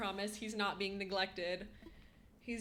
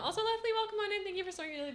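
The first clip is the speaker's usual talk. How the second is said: high loud bright greeting; cheerful welcome; 11 semitones higher, 6 dB louder, faster than usual. Real, not acted. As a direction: welcome the viewer with a lift. Greeting a new viewer.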